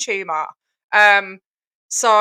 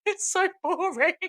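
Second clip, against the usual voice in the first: laughing; 7 semitones higher, 6 dB quieter than usual. Real, not acted. Laughing at boring video.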